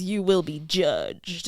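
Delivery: lightly voiced Bible-thumper, low and slow; a hint of gruff southern doom-preaching; gruff preacher. Man on bus warning of judgement, lightly gruff preacher. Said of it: "like, southern gruff voice".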